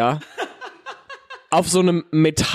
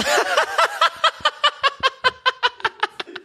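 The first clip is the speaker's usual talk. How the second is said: high burst of laughter. Real, not acted. Laughing while telling his friend the story. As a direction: squeal of laughter, pitched way up. Recalling man sitting on head.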